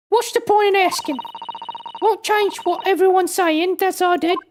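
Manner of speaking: put-on high Cockney girl, sulky; Cockney accent. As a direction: brush them off in Cockney. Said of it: "doing the Cockney accent in her voice".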